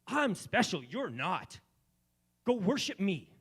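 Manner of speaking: high, loud, mocking boast; mock arrogant Jesus. Preacher mocking what Jesus never said, loud and high. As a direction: play a vain puffed-up god. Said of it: "He didn't say".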